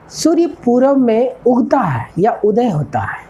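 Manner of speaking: ordinary talk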